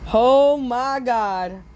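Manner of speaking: very slow mock anguish; hammed-up slow "Oh my God"; drawn-out dismay. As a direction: stretch it out like tragic despair. Grief over exploding Earth.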